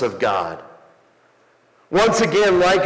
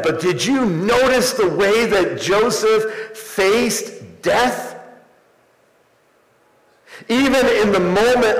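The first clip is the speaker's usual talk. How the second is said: fervent preaching; 10 semitones higher, 4 dB louder than usual. Real, not acted. Preacher telling church of Joseph's deathbed, fervent preaching. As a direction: preach it out, stretching the key words.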